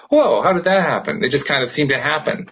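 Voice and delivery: ordinary talk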